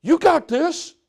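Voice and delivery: wife rallying her husband, lightly played cheerleader. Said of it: "be their cheerleader and say".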